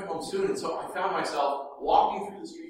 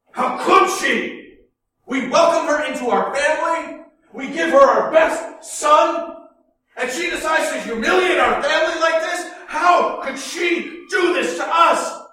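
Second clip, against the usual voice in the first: slow, furious shouting father; angry shout; 10 semitones higher, 10 dB louder than usual. Retold or imitated. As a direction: shout it out in rage. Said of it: "And he said".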